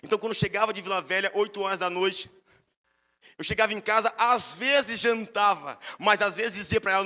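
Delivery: normal talking voice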